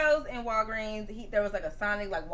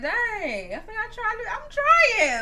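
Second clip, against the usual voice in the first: high-pitched retold exasperation; mock-wounded, rising plea; upset; 10 semitones higher, slower than usual. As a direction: let it climb high, protesting how hard you try. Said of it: "I was, like, upset".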